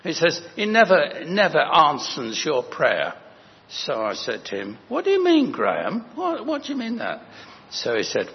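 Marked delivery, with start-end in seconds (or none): 0.5-3.1 NEVER repeated, pitched high and loud; louder, higher retold pronouncement with "never" punched; emphatic quote. Acting out curate's emphatic warning. 4.9-7.2 high-pitched, baffled retort; acted-out disbelief, voice leaping up; incredulous questioning. Retelling his startled challenge to curate.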